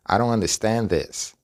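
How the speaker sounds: "I don't" run together as "aron"; informal linked pronunciation. Shown as a demonstration. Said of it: "la oración completa se pronuncia". ("I don't" unido e informal, como "aron")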